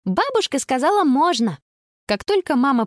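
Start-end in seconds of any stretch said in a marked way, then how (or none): 0.0-1.6 put-on high, thrilled girl's voice; delighted exclamation. Girl tells friends grandmother said yes, delighted and high. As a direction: announce it triumphantly, pitched way up. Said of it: "в восторге кричала она в трубку".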